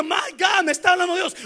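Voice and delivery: stricken sinner. Retold or imitated. Preacher acting a churchgoer crying out to God. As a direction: cry out as if caught in sin. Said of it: "que diga".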